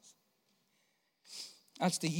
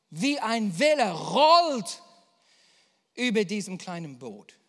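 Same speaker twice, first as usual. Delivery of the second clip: preaching swell; 12 dB louder than usual. Acted. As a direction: let the wave roll through the words. (laute, rollende Predigt)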